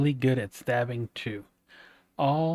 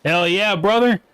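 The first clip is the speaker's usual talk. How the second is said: hyped shout; 6 semitones higher, 8 dB louder than usual. Real, not acted. Streamer hyped after success.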